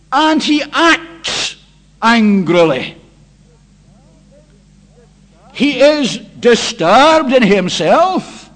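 loud, slow, emphatic preaching; preaching at full force. Acted. Preacher warns the Lord's Day congregation of God's wrath, loud and slow. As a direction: hammer home each phrase to the hall.

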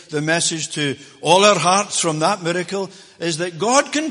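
loud, high, ringing pulpit preaching; full-force preaching. Acted. Preacher proclaiming blessing to the church, loud and ringing. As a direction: drive it home to the congregation.

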